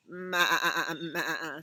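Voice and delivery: wavering, broken maa-aa; bleating goat. Acted. Goat answers Cow in a story, bleating. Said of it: "said the goat".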